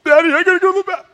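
put-on very high, loud, rapid-fire kid squeal; frantic mock-child voice, racing through the words; voicing other son. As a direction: imitate a little boy urgently yelling for dad. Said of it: "My other son".

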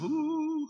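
very high, soft mocking "ooh"; a swooning ooh, high and thin. Mocking seekers swooning for a Holy Ghost experience, a high ooh.

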